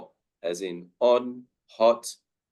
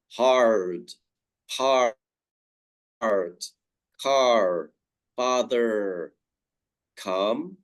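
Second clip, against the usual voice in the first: hard heart car father with the American R sounded; American accent, roll the R in; much slower than usual. Shown as a demonstration. Teacher showing the American accent word by word. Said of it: "American pronunciation will always stress the R".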